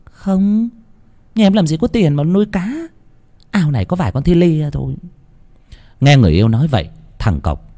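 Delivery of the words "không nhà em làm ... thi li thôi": put-on high, quick young girl's voice; a bewildered girl shrugging it off; puzzled young woman. A narrator's reading. Loan answers her frightened lover, high and puzzled.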